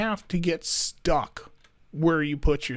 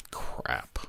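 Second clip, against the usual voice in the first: whispered curse; 7 dB quieter than usual. Real, not acted. Build doesn't line up.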